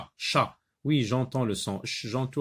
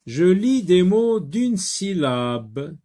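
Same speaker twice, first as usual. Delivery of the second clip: higher, louder reading of the task; title read aloud; 8 semitones higher, 8 dB louder than usual. Shown as a demonstration. Teacher reading the exercise title, higher and louder. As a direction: announce the task to the class.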